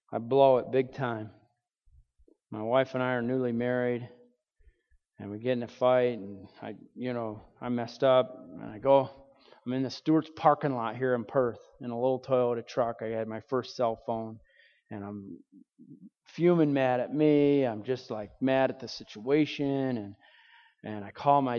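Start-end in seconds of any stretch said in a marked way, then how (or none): none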